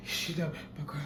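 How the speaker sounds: soft, breathless sick man; a weak, winded whimper. Retold or imitated. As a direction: barely get the words out. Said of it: "can't breathe, can barely speak".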